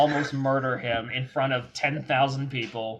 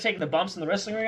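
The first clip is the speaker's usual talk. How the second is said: ordinary talk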